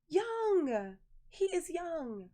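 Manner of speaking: high, drawn-out scandalized exclamation; scandalized emphasis. Real, not acted. Gossip about aunt's young boyfriend.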